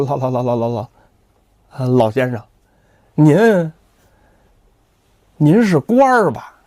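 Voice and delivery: put-on a scared young man stuck on his words; 寇太松吓得结结巴巴; frightened stammer. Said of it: "那说话也结结巴巴了".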